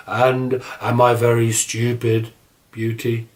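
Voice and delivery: a shy monster asking, drawn out; Beast's voice. A narrator's reading. Beast asks Beauty again.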